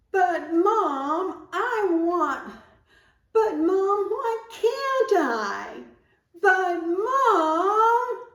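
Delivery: put-on whiny arguing child. Shown as a demonstration. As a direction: wail at Mom like a protesting kid. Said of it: "Let's try it".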